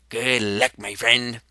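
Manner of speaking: normal talking voice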